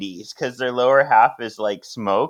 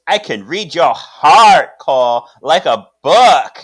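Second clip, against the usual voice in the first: menacing villain; 4 semitones higher, 9 dB louder than usual. Retold or imitated. Knox menacing Cole.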